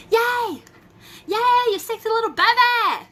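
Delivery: high, loud gleeful cheering; excited cheer. Real, not acted. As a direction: cheer her on playfully.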